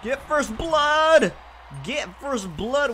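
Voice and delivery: high, drawn-out hype shout; psyching myself up. Real, not acted. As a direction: chant it to pump yourself up. Hyping himself for first kill.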